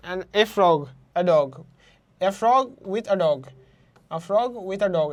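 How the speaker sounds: "frog" and "dog" punched, falling after each; stressed nouns. Shown as a demonstration. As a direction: hit "frog" and "dog", keep "a" and "with a" light. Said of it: "এখানে stress করা হচ্ছে". ("frog" আর "dog"-এ জোর, বাকিটা হালকা)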